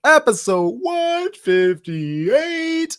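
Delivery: slow, high, drawn-out welcome; show intro announcer. Acted. Podcast host opening the show to listeners, slow announcer voice. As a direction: stretch every word like a radio host.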